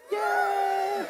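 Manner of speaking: high, loud whoop of a cheer; rally the Californians in the crowd. Real, not acted. Speaker whipping up Californians in the audience with a loud cheer.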